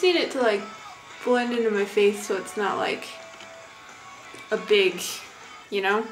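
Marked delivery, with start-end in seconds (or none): none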